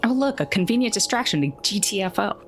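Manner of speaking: usual speaking voice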